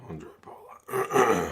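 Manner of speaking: Andre Pollard slow, whispered and loud-breathed; voice gives out. Real, not acted. Calling the kicker for stream viewers, voice failing to a whisper. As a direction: croak Pollard out on a voice that's gone. Hoarse voice gives out mid-commentary.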